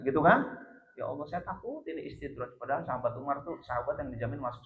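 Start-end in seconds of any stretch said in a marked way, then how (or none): 1.0-2.6 hushed, mildly fearful prayer; imitated tearful fear. Umar fears his blessings are a trap, softly fearful. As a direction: retell it as a soft worried plea. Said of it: "dia nangis".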